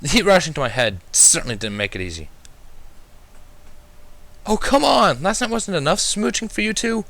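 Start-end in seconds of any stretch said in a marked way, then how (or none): 4.4-7.0 high, loud, annoyed little dragon; young dragon's appalled whine; appalled groan. A narrator's reading. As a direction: groan it out, fed up with the kissing. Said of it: "groaned out, sounding appalled".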